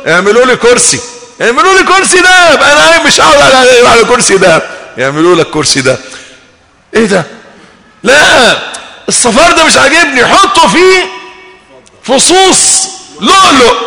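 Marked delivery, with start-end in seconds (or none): 0.1-4.6 bossy demands. Pretend. Spoiled man ordering in paradise. 8.0-13.7 high, picky, hammed-up orders; a spoiled king rejecting gold; spoiled man in paradise ordering servants, mock bossy; mock bossy demands. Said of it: "طب بس ما تزعقش".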